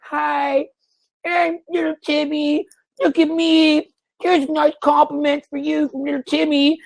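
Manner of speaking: hammed-up squeaky little Timmy; mocking timid guy. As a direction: simper it like a nervous nice guy. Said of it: "they're like".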